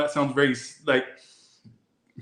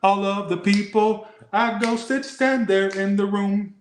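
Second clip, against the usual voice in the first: eerie voice; 7 semitones higher, slower than usual. Acted. Tarot reading line voiced dramatically.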